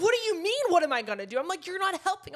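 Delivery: high, loud exasperated reply; frantic snapping. Retold or imitated. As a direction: fire back at him, rattled.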